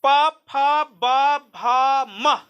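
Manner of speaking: very slow, lips meeting on each letter; slow letter-by-letter demonstration; slow demo recitation. Shown as a demonstration. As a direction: sound out each letter slowly. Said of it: "पफवा का उच्चारण स्थान देखिए".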